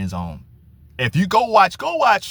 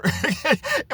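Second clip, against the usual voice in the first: a real laugh shooting up high; real amused laugh; high rising laugh. Real, not acted. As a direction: chuckle at the critics. Laughs at the critics.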